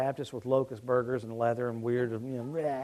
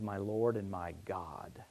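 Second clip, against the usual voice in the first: slow breathless whisper; awed whisper; 7 semitones lower, 11 dB quieter, slower than usual. Acted. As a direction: breathe it out in awe.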